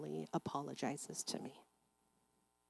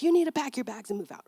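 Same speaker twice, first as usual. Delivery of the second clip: a louder, faster mock angry father; 5 semitones higher, 5 dB louder, faster than usual. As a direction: throw her out, a touch hammed-up. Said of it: "He's like".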